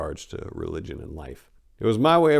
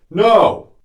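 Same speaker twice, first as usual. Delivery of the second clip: a big exasperated NO, much higher and louder; loud shout; 12 semitones higher, 11 dB louder than usual. Retold or imitated. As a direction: shout it together as one. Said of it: "in unison replied".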